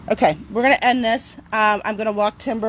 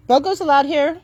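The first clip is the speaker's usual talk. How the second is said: higher and louder, cheery; upbeat reassurance; 7 semitones higher, 5 dB louder than usual. Real, not acted. Telling Timber that Bogo is allowed, upbeat. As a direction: sell it as good news to the dog.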